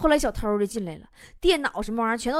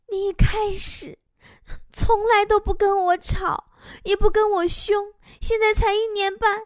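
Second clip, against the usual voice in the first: aggrieved wife; 9 semitones higher than usual. Acted. Wife complains to husband during a quarrel, mock-tearful and aggrieved. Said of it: "媳妇儿特别委屈呀".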